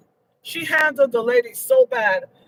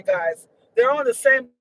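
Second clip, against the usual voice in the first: ordinary talk, nothing marked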